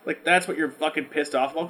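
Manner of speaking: ordinary talk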